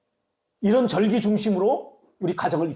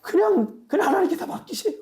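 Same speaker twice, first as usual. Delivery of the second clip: high pleading; 12 semitones higher than usual. Real, not acted. Pleading with congregation.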